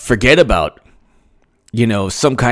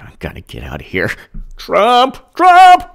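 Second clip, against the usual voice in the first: mock meltdown; 11 semitones higher than usual. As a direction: whine then wail like a broken partisan. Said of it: "They're like".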